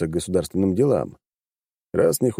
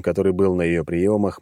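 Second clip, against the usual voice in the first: usual speaking voice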